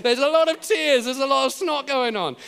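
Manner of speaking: loud, high comic exclamation; hammed-up emotional overflow; mock weepy outburst. Comic retelling of everyone crying.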